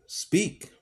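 high, sharp shouted order; barked command. Acted. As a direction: bark the order. Legree demands answer.

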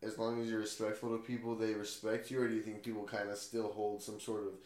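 normal talking voice

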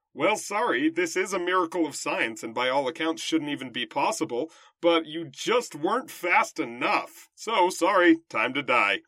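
loud, pompous royal bellow; king voice. Acted. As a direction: boom it out like a callous king. King condemning the girl.

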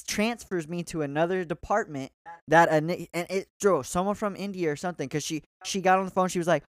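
usual speaking voice

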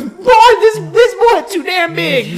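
very high, loud laughing Chris Tucker rant; Chris Tucker impression. Chris Tucker gawking at a giant, very high loud rant. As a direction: shriek in amazed disbelief. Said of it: "he looks up and he goes".

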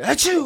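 loud, high exaggerated sneeze; fake sneeze. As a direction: ham up a big sneeze. Joking about sneezing butterflies.

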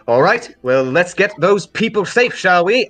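loud, high, hearty send-off; rally the group, pitch climbing; brisk in-character call to action; rousing rally. Acted. Character rallies others before leaving.

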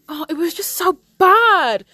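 high, loud exasperation; exasperated outburst. Real, not acted. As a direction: wail the complaint, leaning hard on BAD. Venting about a terrible trailer.

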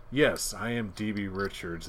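"Yes, I am D B Richards" slow and precisely articulated; clear, careful enunciation. Pretend. Imagining co-host settled down, "Yes, I am D B Richards" enunciated carefully. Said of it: "with more clarity and more enunciation".

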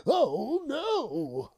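high, loud fake fright; mock panic. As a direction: cry out in pretend terror.

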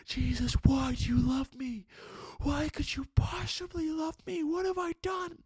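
high, loud, desperate screamed questions; screaming. Retold or imitated. A man screaming to Jesus why he is loved, very loud. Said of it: "I was screaming".

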